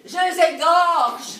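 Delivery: loud, drawn-out threat; vengeful outburst. Acted. Betrayed wife swearing revenge on the two lovers, loud vengeful outburst. Jilted wife vows to kill both.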